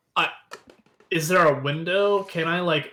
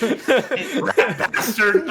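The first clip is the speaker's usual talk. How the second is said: loud laughing yell; mock shout; 8 semitones higher, 6 dB louder, much slower than usual. Player shouts mock NPC line.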